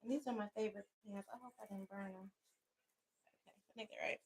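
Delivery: words spoken well away from the microphone; far from the mic. Real, not acted. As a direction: talk while turned away to check your pants. Checks pants away from mic.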